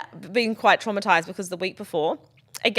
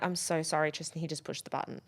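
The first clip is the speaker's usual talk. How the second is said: meek quiet apology; 3 semitones lower, 11 dB quieter than usual. Retold or imitated.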